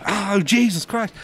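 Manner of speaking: high, drawn-out retold panic; mock freak-out as if rushing inside; freaked-out exclamation. Teen manager spots celebrities arriving, freaking out. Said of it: "I freak out".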